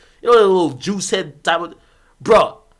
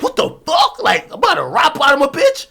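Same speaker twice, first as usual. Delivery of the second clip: higher, louder waking shout; startled yell; 8 semitones higher, 5 dB louder than usual. Retold or imitated. Reenacting jumping out of sleep, mock startled yell. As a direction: jolt awake yelling. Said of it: "that's how I jumped out of my sleep".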